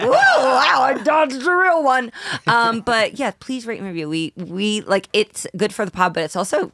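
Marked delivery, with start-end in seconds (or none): 0.0-2.1 loud, higher relieved whoop; child-free listener laughing at the hosts. Retold or imitated. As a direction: exclaim like you barely escaped. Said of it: "being like".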